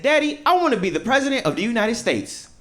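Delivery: put-on high kid's voice; child voice. Child telling daddy their dream. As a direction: pipe it up like a small child. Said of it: "and said".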